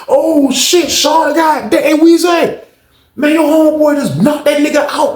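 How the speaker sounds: high, hollering astonishment; retold excited shouting. Richmond men telling Weezy about the knockout, retold excited shouting. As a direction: shout the news up the tier, voice way up high.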